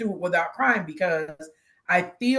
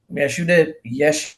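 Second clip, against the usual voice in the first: usual speaking voice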